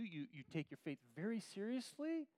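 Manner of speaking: usual speaking voice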